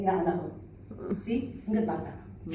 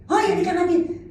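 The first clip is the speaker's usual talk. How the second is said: higher, louder call of Hoy; raised household shout; mock yell; 9 semitones higher, 3 dB louder than usual. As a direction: bark it like family yelling at home. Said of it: "hindi ka susunod hanggat di nagsisigawan".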